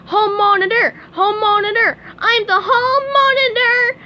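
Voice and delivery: high loud cartoon chant; excited shouting. A narrator's reading. SpongeBob chants his new title racing round the class, high and loud. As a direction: shout it over and over while bouncing. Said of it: "he shouted".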